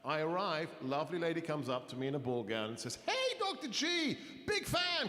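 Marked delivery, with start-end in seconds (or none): 3.1-5.1 high-pitched gushing fan greeting; squealed, drawn-out hello from a fan; excited fan. Retold or imitated. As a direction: imitate an eager fan calling out, pitched way up. Acting out an eager fan.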